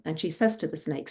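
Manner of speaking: plain reading voice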